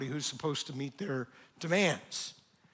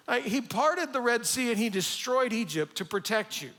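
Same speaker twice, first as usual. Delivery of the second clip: ordinary talk, nothing marked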